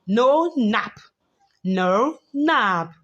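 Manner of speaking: normal talking voice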